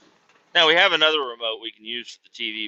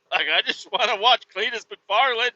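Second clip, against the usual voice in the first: high-pitched comic whining; worked up, voice pitched high; frustrated whine; 10 semitones higher than usual. Retold or imitated. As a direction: plead it in exasperation.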